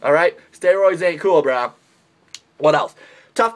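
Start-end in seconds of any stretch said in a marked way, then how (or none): none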